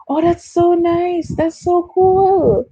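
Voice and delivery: high, slow, drawn-out gush; cooing, high-pitched enthusiasm; gushing delight. Real, not acted. Gushing reaction to viewer's country.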